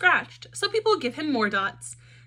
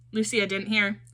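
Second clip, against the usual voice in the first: ordinary delivery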